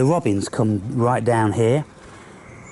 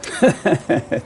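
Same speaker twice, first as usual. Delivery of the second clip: short laugh; 7 semitones higher than usual. Real, not acted. Laughs at the squirrel.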